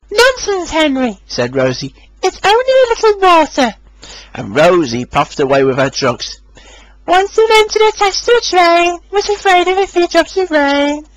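high mocking singsong; teasing Rosie. A narrator's reading. Rosie teasing Henry about the rain, high. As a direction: poke fun at Henry.

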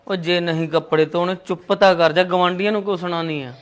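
loud exasperated shout at the wife; angry shout. Acted. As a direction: yell at her to shut up. Husband yells to stop quarrel. (ਗੁੱਸੇ ਵਿੱਚ ਉੱਚੀ ਆਵਾਜ਼ ਨਾਲ ਝਿੜਕਣਾ)

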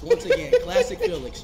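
high, giggling jeer; laughing mockery. Real, not acted. Player ribbing Felix after a blunder, laughing mockery. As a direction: crack up while teasing a teammate.

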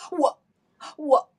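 quick gasp, then a punched 我; high, loud 我 after a snatched breath; breath before speaking. Shown as a demonstration. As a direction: inhale sharply and push out 我 on the off-beat. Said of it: "他会吸一口气才说".

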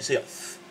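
teaching the Welsh "th" in dathlu; Welsh th sound. Shown as a demonstration. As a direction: hold "th" as a long breathy hiss. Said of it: "it's got the T H in it".

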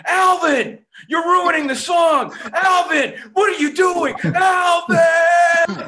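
high, loud exasperated yell at Alvin; Dave yelling at Alvin. Retold or imitated. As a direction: shout it like a fed-up record producer. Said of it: "Dave would go".

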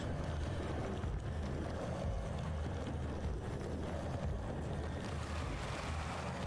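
held breath, a sharp fearful gasp. Real, not acted. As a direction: gasp as the monster passes. Monster passes close.

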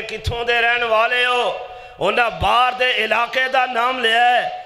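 forceful, high-pitched preacher's retelling; full-force preaching. Retold or imitated. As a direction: retell a stern rebuke in a preacher's ringing voice.

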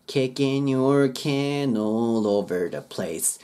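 slow "Kicking your can all over the place"; linked words. Shown as a demonstration. Teacher showing the full linked line, slow and joined. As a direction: run "can" into "all" as "ca-nall". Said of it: "canの音とallのOがくっついて".

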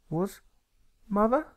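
put-on a pleading little boy; stuttering boy. A narrator's reading. Alfred begs his mother for news, stammering.

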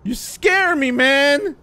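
high, loud reproach; shaken scolding. Acted. Friend scolds Bobby for scaring him, shaken. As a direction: shout the reproach after a fright.

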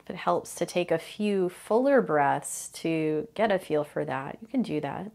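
calm, unhurried meditation guide with long pauses; guided meditation. Real, not acted. Host guiding listeners through mindfulness of breathing, calm guided meditation. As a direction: ease listeners into the breath, phrase by phrase.

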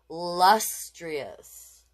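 Lustrous said loud and slow, falling; savoring the word. Shown as a demonstration. Teacher modeling a word for kids to repeat, savoring it. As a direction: relish Lustrous slowly. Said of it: "Everybody say it with me".

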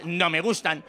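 ordinary delivery

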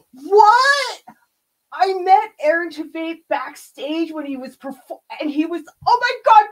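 very high, loud squeals of disbelief; squealing excitement. Real, not acted. Artist squealing over a fan's backstage story, high excited shrieks. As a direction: shriek it out, overwhelmed with delight. Reading a super chat in excitement.

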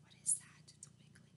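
hushed, unvoiced wonder; whisper. A narrator's reading. Pointing out the worm, whispered. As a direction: breathe it to the kids.

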